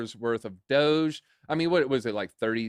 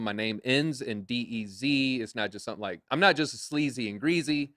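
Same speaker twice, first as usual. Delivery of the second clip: ordinary talk, nothing marked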